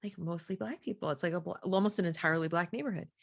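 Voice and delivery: quiet imitation of a child; daughter answering. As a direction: answer softly, as a kid thinking aloud. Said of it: "She goes".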